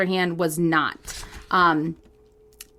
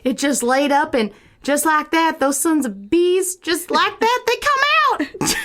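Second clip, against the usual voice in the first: put-on Southern accent; 5 semitones higher than usual. Improvising an alien abduction witness, Southern accent. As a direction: play a rattled Southern witness. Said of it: "If you like our Southern accent".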